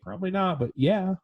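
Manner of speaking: fake-reassure like a doubtful mom; mock mom voice. Mom answering her child's hero dream, hammed-up high and sweet.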